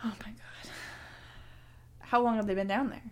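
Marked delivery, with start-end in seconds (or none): 0.0-0.7 breathless horrified whisper; shocked whisper. Acted. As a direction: gasp it out under your breath.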